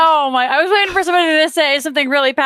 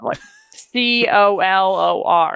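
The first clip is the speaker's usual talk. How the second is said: ordinary talk, nothing marked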